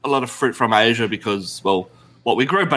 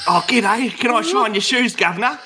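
put-on Cockney accent; 9 semitones higher than usual. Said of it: "This is how we talk".

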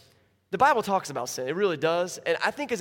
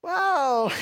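high, loud, teary mock thanks; fake gratitude; 10 semitones higher, 6 dB louder, much slower than usual. Girl unwrapping a sweater, faking teary thanks. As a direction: gush with disappointed politeness. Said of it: "She goes".

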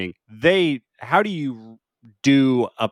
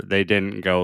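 ordinary talk

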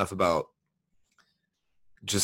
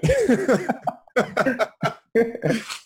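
high laugh; 6 dB louder than usual. Real, not acted. Laughing at the joke.

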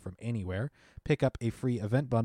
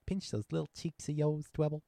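put-on squealing baby talk aunt; 5 semitones higher, 5 dB quieter than usual. Cooing at Dwebble.

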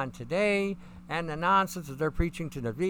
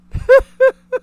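loud, high bark of laughter; 15 dB louder than usual. Real, not acted. Host cracking up at chat, loud laugh. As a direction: burst out laughing. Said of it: "Reason why I'm laughing".

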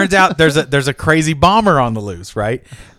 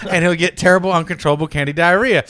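ordinary talk, nothing marked

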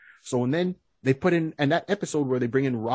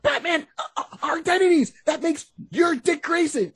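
high, stammering mock panic; hammed-up flustered protest; Robin in panic; 13 semitones higher than usual. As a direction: splutter in a high sidekick voice. Robin panics over revealed identities.

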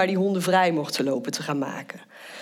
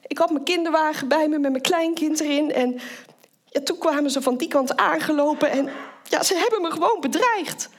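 put-on much higher, upset older woman's voice; upset elderly lady; 8 semitones higher than usual. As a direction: voice the shaken old lady pointing out the spot. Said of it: "best wel emotioneel".

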